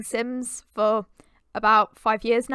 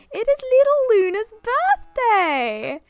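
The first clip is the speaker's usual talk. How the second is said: excited announcement; 8 semitones higher, 7 dB louder, slower than usual. Real, not acted. Announcing Sim baby's birthday.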